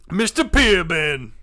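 loud, slow swagger; hammed-up boast. Riffing with friend, slow loud name boast. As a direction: stretch the name out proudly. Continuing the bit.

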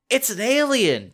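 put-on high, loud boy's exclaim; excited exclaim. Boy exclaims at the alien, high and excited. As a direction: blurt it out, thrilled. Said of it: "Jordan exclaims".

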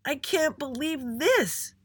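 high, drawn-out shout leaning on THIS. A narrator's reading. Little Andy repeats himself to his parents, shouting. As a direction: stretch it out, hitting THIS hardest. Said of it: "he shouted again".

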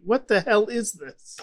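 normal talking voice

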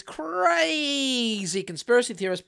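long drawn-out, sarcastic "crazy"; sarcastic, hugely elongated word; mocking emphasis. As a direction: stretch the first vowel of "crazy" in mockery. Mockingly echoing the article's framing.

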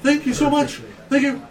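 high, gushing thanks; grateful rescued woman. Acted. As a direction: thank them in a high-pitched voice. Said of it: "She's like".

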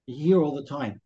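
ordinary delivery

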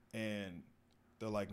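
ordinary talk, nothing marked

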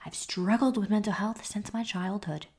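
trying too hard to be listenable; annoying presenter voice. Shown as a demonstration. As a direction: demonstrate a strained, unrelaxed podcast voice. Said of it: "That is how I was talking".

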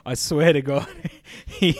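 ordinary talk, nothing marked

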